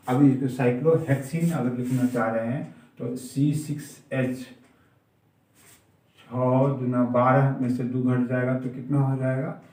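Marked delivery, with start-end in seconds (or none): none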